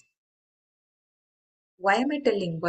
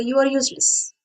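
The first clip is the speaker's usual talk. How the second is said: frustrated scolding; 7 semitones higher than usual. Retold or imitated. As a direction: snap at the lazy kid in mock frustration. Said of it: "in all frustration".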